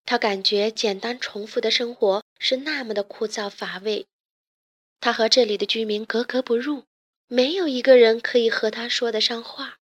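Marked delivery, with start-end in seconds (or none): none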